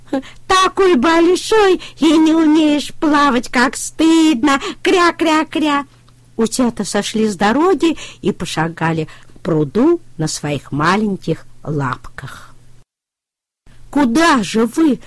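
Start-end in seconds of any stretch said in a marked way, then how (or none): 0.1-5.9 high, louder teasing duckling voice ending in quacks; mocking ducklings. A narrator's reading. Утята стыдят трактора, насмешливо и высоко. 13.9-15.0 higher, louder call after them. A narrator's reading. Трактор кричит вслед уходящим утятам. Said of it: "крикнул трактор".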